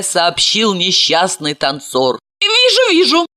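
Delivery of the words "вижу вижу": high sympathetic woman's voice. A narrator's reading. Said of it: "вторила ему Ася".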